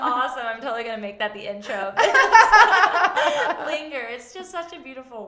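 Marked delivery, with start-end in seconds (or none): 0.5-2.1 fast, loud, higher through laughter; laughing. Real, not acted. Host excited about song intro, laughing fast. 2.1-3.6 high, loud burst of laughter. Real, not acted. Host cracking up at her own idea, loud high laugh.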